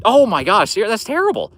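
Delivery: high, loud gasp of shock; sudden high shocked outcry at a viewer's story; shocked dismay. Real, not acted. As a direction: recoil at bad news, voice jumping up. Reacting to a bad taxidermy story.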